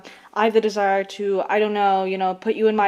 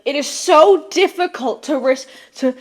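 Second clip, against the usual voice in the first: creepy mock quote; 3 semitones higher, 4 dB louder than usual. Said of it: "It's a little creepy where he's like".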